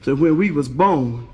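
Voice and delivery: put-on low-pitched little boy explaining; comically deep schoolboy voice; heavy voice. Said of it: "the one with the heavy voice".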